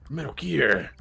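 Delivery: Snake voice. Acted. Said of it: "impossible not to do a snake voice".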